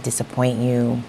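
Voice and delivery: usual speaking voice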